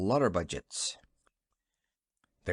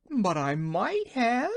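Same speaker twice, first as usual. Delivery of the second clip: high, slow protest on the edge of tears; insist, about to cry; tearful exclaim; 11 semitones higher, slower than usual. Acted. Wife protests to the Wizard, high and tearful. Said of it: "she exclaimed".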